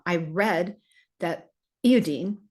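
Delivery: put-on light British accent, iodine as ee-oh-deen; a hint of a British accent; mild imitation of a British accent. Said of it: "he said in his British accent".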